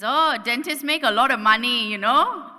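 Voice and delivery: loud Singaporean uncle remark; knowing cabbie voice; Singlish taxi uncle. Retold or imitated. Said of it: "So then he says".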